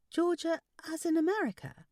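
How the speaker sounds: high daughterly question; puzzled daughter. Acted.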